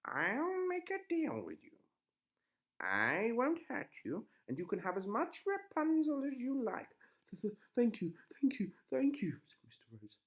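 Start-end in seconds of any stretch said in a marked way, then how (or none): 0.1-6.9 put-on sly old witch. A narrator's reading. Witch voiced. 7.3-9.4 high, loud stammering thanks; nervous thanks. A narrator's reading. Relieved stammering husband.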